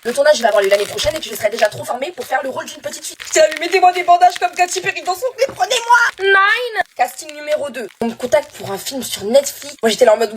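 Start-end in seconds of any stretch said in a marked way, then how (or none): 3.3-6.8 mock pleading. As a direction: beg them playfully.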